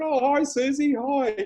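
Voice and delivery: mock innocent hello; awkward cheery hello. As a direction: fake breezy while the car is towed off. His own awkward reply.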